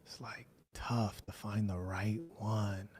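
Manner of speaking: whispered aside to himself; hushed whisper to himself. Real, not acted. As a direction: mutter it under your breath while searching. Searching for clip, whispering aside.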